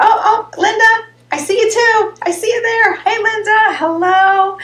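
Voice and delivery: high, loud happy surprise; spotting viewer Linda in the chat, loud delighted hello; excited greeting. Real, not acted. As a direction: burst out when you spot a friend.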